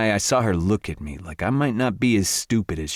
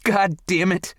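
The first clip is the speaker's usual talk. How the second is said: high, raised cry of frustration; shouted; 12 semitones higher than usual. A narrator's reading. Angry woman shouting at a door. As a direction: yell it up high at the slamming door. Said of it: "Morgan shouted".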